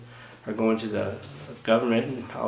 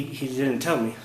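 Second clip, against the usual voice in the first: normal talking voice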